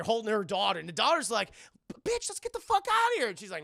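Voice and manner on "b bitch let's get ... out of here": put-on high, loud child voice, urging to flee; panicked kid voice. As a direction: squeal at mom to get out now.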